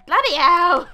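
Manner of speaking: loud burst of exasperation; frustrated shout. Real, not acted. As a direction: curse loudly at the failed attempt. Frustrated by failed game attempts.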